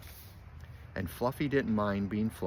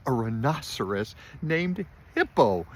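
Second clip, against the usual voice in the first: loud, high exclamation of hilarity; crying out, amused; 5 semitones higher, 7 dB louder than usual. A narrator's reading. Fluffy howling at the rhinoceros's name, crying out. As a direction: cry it out, punching each word. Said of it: "he cried".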